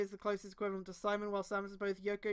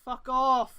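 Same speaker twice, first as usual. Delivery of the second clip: exasperated yell; 3 semitones higher, 9 dB louder than usual. Real, not acted. Outburst after the whisper.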